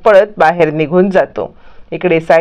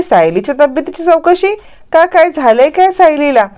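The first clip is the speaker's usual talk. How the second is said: retold surprise, pitched higher; lightly puzzled, higher-pitched questions; mildly surprised questioning; 7 semitones higher than usual. Kusum questions the boy's inquiry.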